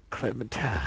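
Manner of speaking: low, soft character impression; Lee. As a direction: murmur the name low, in character. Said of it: "Lee comes back and he's like".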